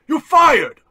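yelled high and loud; furious shout. Acted. Boss screaming at his loyal servant, furious. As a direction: fire him with a full shout.

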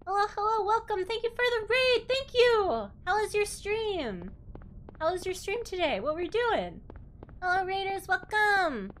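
high, bubbly welcoming voice; squeaky-sweet anime girl host; cute anime streamer. Acted. Welcoming raiders in persona.

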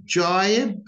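"Jibe" with a full, clear British diphthong; British pronunciation. Shown as a demonstration. Teacher showing learners the British accent. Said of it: "Notice the difference".

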